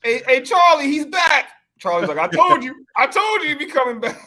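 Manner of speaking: high, loud mechanics cackling to each other; gloating mechanics. Retold or imitated. Imitating mechanics spotting a returning customer, high loud gloating. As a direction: crow about the sucker coming back.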